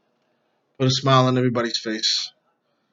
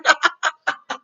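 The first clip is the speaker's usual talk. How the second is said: laughing. Real, not acted. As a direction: crack up at your own imitation. Laughs at his own imitation.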